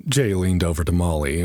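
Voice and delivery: usual speaking voice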